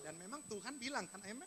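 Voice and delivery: ordinary talk, nothing marked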